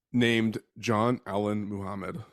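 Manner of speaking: normal talking voice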